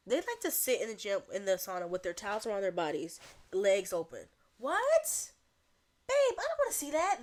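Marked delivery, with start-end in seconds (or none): 4.6-7.2 high, loud scandalized outburst; appalled. Acted. Reacting to old people bare in the sauna, appalled. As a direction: squawk it in hammed-up disbelief. Appalled at naked gym-goers.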